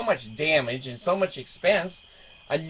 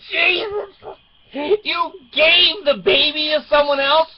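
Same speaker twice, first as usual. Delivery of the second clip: much higher, disbelieving outcry; shocked dad; 10 semitones higher, 4 dB louder than usual. Retold or imitated. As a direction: react as if you can't believe your ears. Dad shocked by daughter's news.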